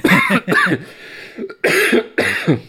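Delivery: sudden hard cough; coughing fit. Real, not acted. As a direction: cough as if choking. Coughing fit mid-podcast.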